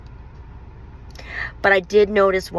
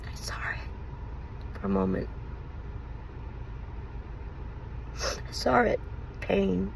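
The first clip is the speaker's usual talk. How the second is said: almost crying, soft and breaking; tearful; 4 semitones higher, 10 dB quieter than usual. Real, not acted. Telling viewers about his guilty face, near tears. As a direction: voice fading to a tearful hush. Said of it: "that's incredibly sad".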